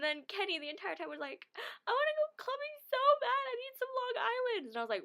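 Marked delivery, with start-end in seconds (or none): 1.9-4.7 mock high pleading voice; imitating a friend begging to go out; high whiny friend. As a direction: whine it like a begging kid. Friend voiced whining to go clubbing.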